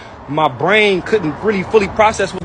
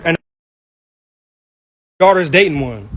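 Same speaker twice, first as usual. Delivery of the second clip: usual speaking voice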